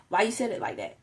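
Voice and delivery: ordinary delivery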